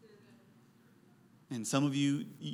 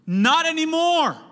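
firm shout; 12 semitones higher, 9 dB louder, slower than usual. Real, not acted. Preacher's firm retort.

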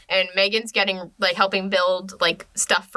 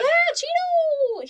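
very high, drawn-out pet voice; pet talk; much slower than usual. Real, not acted. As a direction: coo high and slow to a pet. Talking to a pet called Cheeto.

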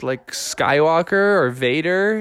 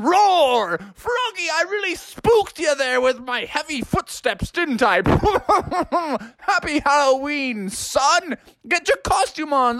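high, hearty roaring dad voice; jolly dinosaur dad; 13 semitones higher than usual. Acted. Dad in dinosaur costume greets Froggy, jolly roaring. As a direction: roar it out playfully, high and chuckling. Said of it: "he said".

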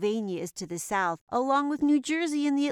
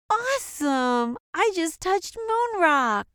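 put-on broad singsong kids theatre; 5 semitones higher, slower than usual. Kid thrilled by moon rock.

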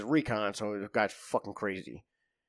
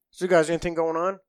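higher, louder mock-casual question; casual code question; 6 semitones higher, 5 dB louder than usual. Guard asking buddies if the boss is near, mock-casual. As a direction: toss it off like an innocent check-in.

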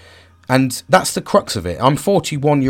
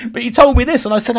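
ordinary talk, nothing marked

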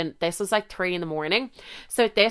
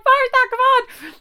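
high, louder call from the taxi; impatient call; 5 dB louder than usual. Retold or imitated. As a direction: hurry her up. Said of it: "The girl's like".

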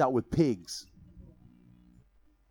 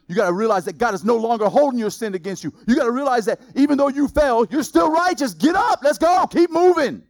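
building, louder and higher rally call; preaching at full force; 8 semitones higher, 7 dB louder than usual. Acted. Preacher urges the church to keep moving, rising. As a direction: rouse the congregation to get up.

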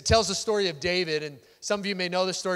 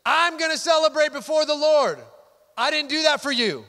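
loud, high, emphatic declaration; David's defiant response; 8 semitones higher, 7 dB louder than usual. Acted. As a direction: proclaim it forcefully, standing your ground. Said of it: "and David responds".

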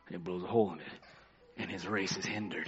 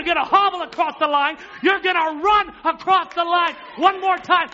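loud, high preached shout driving off the devil; father shouting; 13 dB louder than usual. Retold or imitated. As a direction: rebuke the devil at full force. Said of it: "He said".

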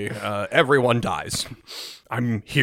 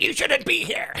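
lightly voiced old woman; 14 semitones higher than usual.

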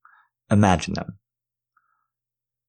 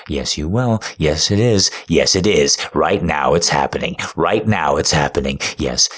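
right in your ear; 2 semitones lower, 5 dB louder than usual. Acted. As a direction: press in close and take charge.

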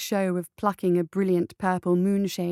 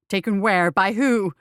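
urgent demand; 6 dB louder than usual. Acted. Urgent questions.

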